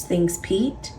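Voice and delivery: ordinary delivery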